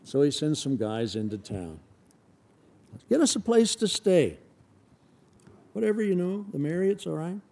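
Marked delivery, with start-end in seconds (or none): 3.1-7.4 casual disciple voice. Acted. Disciples asking for lodging.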